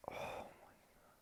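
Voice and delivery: awed whisper. Real, not acted. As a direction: breathe it out in a whisper. Stunned by the twist.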